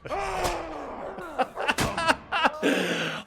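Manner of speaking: long high-pitched laughter. Real, not acted. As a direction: crack up laughing. Laughs at enemy taunt.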